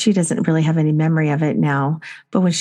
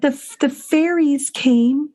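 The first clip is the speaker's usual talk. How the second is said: little daughter's voice; 8 semitones higher, slower than usual. Retold or imitated. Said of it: "And she said".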